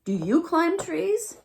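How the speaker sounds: high, slow question; little raccoon asking. A narrator's reading. Raccoon asking the fawn about trees, high and slow. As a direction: ask it slowly and sweetly. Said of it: "said the little raccoon".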